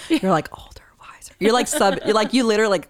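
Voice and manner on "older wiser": whispered suggestion. Pretend. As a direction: whisper it into his ear. Mock whisper into ear.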